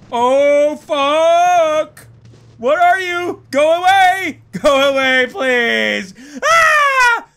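high, loud pleading into a scream; terrified. Real, not acted. Player begging the pursuer to go away, terrified. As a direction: beg it away in rising panic.

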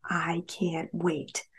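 slow, breathy hush of longing; savoring the words in a whisper; soft whisper. A narrator's reading. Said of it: "She paused".

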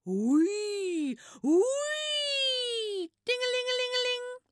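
high loud siren wail then ringing bell sounds. Retold or imitated. Fire engine passing the children, high loud siren and bell.

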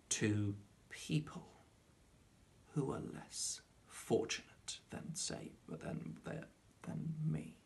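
slow, halting, fading to a near whisper; soft, hesitant, barely voiced finish; fading awkwardly. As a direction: trail off very softly, groping for the end. Awkward answer fading out in interview sketch.

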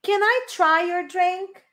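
slow, clear English model; slow model sentence. Shown as a demonstration. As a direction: enunciate slowly for repetition. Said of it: "Listen and repeat". (frase modelo lenta em inglês)

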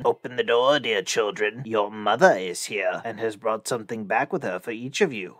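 lightly voiced rough wolf, low; a hint of a wolf's low, rough voice. A narrator's reading. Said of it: "by the rough voice".

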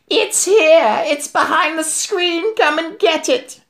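very high, screechy witch's yell; triumphant yell. A narrator's reading. Witch calling the others to the hidden boy, triumphant yell. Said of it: "yelled triumphantly".

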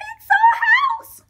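very high, loud squeak with a cry in it; squeaky mouse crying out; squeaking mother mouse. Acted. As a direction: wail it like a tiny distraught mouse. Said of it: "squeaked the mother mouse".

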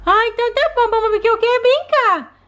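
put-on high little toddler voice; a small child talking to her mom; baby doll voice. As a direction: play the sick baby begging to play.

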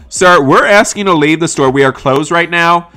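loud acted shopkeeper's command; firm loud order. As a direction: call out sternly to a customer. Ordering a customer out.

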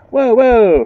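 high, loud startled whoa. Real, not acted. Player startled by a stranger, yelping whoa. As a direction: jump back in fright.

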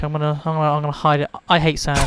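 ordinary talk

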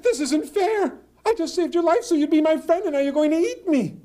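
high, loud squeaky protest; a little squirrel pleading for fairness; frightened protest. Acted.

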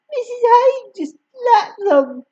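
tearful complaint; crying. A narrator's reading. Amy still crying.

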